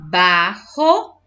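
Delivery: "Barro" split into two loud beats; syllable by syllable. Shown as a demonstration. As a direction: separate both syllables of "Barro" firmly. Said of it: "Separe as sílabas das palavras".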